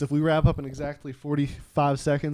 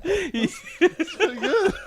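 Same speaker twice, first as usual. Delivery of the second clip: laughing; slower than usual. Real, not acted. Laughing at improvised song.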